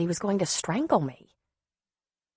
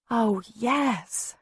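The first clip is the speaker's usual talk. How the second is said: higher, understated near-tearful answer; trying not to cry; 5 semitones higher than usual. A narrator's reading. Woman thanking boyfriend for earrings, trying not to cry. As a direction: lift the pitch, holding back tears. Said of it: "trying hard not to cry".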